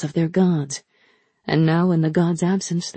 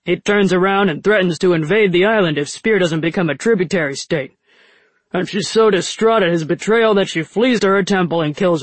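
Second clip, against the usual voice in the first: slightly louder rough drinker; lightly voiced rough soldier; 3 dB louder than usual. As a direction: tell it like a tipsy soldier. Baji voiced character.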